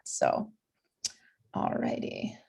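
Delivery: breathy whisper to self; whispered aside. Real, not acted. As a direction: breathe it under your breath. Host mutters to herself.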